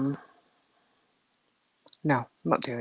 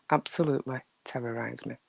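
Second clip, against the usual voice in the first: ordinary talk, nothing marked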